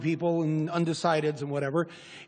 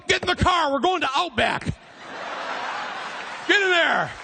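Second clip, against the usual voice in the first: mock decisive conservative; 8 semitones higher than usual. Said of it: "They're like".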